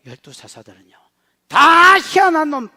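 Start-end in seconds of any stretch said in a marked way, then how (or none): 1.5-2.7 loud, slow, drawn-out call; emphatic shout. Real, not acted. Preacher calling the judges strange men, loud and slow. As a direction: hammer each word out slowly.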